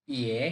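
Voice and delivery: ইয়ে low and broken; ইয়ে in a low broken third tone; Mandarin third tone. Shown as a demonstration. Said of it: "third tone ভেঙে বলতে হবে".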